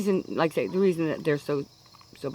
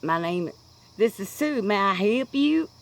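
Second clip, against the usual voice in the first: put-on Kentucky accent; 4 semitones higher, 4 dB louder than usual. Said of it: "I pick up that accent".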